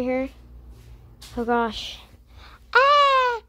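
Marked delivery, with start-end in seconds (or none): 2.7-3.4 startled yell. Real, not acted.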